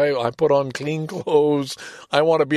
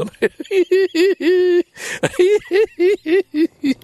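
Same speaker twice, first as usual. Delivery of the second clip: long loud high laugh; real laughter; 6 dB louder than usual. Real, not acted. Reading "Horner syndrome" in his report, laughing hard. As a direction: laugh helplessly at an unknown term. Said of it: "I don't know why it's funny".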